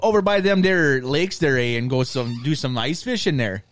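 put-on a Minnesota accent as everybody imagines it; mock Minnesota accent. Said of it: "everybody thinks we fucking sound like".

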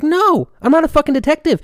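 security guard losing patience with dispatch questions; exasperated retort. Retold or imitated. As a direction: snap back at the dispatcher in disbelief. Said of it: "I was like".

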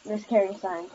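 ordinary delivery, nothing marked

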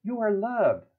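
a cheery high little creature offering comfort; chipmunk. Acted. A chipmunk hugging its friend, high and cheerful. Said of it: "the other one hugs it and goes".

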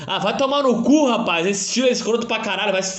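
sudden high angry yell; angry outburst. Real, not acted. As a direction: blow up at them. Ranting at TikTok style. (explosão de raiva)

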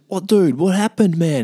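high, insistent bewilderment; baffled questioning. Retold or imitated. Punched man presses his friend for answers, baffled. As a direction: demand answers in acted confusion.

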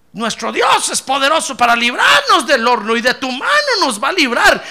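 shouted high and loud at the king, full of faith; defiant declaration. Retold or imitated. Young believer shouting his faith at the king, bold and loud. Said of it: "uno de ellos le dijo".

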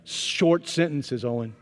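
each word laid out slowly; slow it down to show what short means; slow, deliberate advice. Retold or imitated. Pastor coaching the head coach on translators, slow and deliberate. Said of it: "Here, here's what I want you to know".

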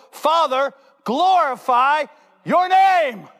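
high, slow shout of prayer; anguished cry to heaven. Acted. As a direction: cry out to the Father. Jesus crying out to heaven.

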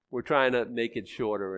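ordinary delivery

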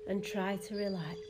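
soft fading meditation voice; guided meditation. Acted. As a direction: guide them gently into calm. Guided meditation over music.

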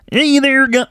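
high, squeaky frog puppet voice; Kermit the Frog voice. Retold or imitated. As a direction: chirp it like Kermit. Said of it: "You go".

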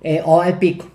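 ordinary delivery

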